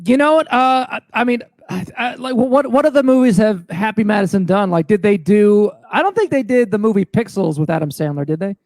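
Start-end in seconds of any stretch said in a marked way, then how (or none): none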